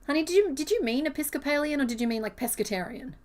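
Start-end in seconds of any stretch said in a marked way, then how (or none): none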